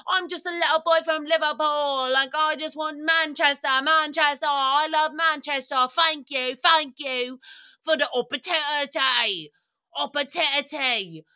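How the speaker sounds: high, loud mock Liverpool lad; hammed-up humble footballer accent; mock Beckham. Imitating Beckham as humble Liverpool boy, loud and high. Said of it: "he was like".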